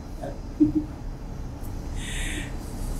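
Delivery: low, hushed chuckle; laugh. Real, not acted. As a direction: chuckle softly to yourself. Man laughs during his confession.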